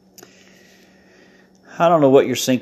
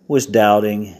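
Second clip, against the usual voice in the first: usual speaking voice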